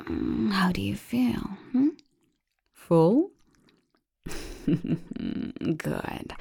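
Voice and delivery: soft, slow whisper close to the ear; intimate whisper. Acted. Lover to partner in bed, hushed whisper. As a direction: hush it down to a bare whisper.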